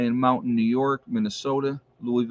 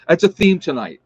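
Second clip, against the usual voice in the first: laughing; 5 semitones higher, 8 dB louder than usual. Real, not acted. Laughing remark to live chat.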